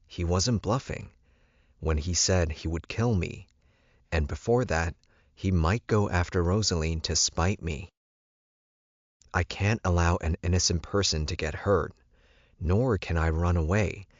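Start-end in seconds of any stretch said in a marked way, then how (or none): none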